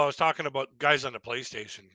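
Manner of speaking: usual speaking voice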